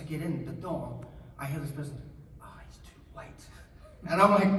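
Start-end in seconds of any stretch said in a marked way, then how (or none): 2.4-3.4 barely audible mock aside; very soft, voiceless murmur; hushed remark. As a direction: mutter it under your breath like a bystander. Overheard remark at the audition.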